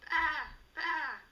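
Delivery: high, loud bleating baa; sheep bleat. Acted. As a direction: bleat out for help. Sheep calls for farmer.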